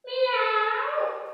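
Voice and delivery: a very high little mew; tiny kitten meow. A narrator's reading. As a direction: squeak it out like a kitten. Tiger's roar comes out tiny.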